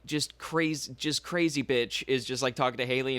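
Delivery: ordinary delivery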